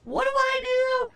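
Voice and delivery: hammed-up exasperation; exasperated. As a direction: throw it up in disbelief. Exasperated rhetorical question.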